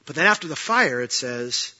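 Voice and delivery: ordinary delivery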